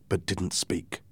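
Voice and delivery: plain reading voice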